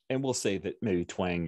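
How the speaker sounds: ordinary talk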